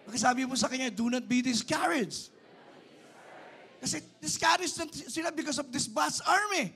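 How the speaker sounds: high, loud preacher's call; fervent preaching. Real, not acted. Preacher rallying discouraged people facing a vast army, loud exhortation. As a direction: raise it high and urge the crowd on.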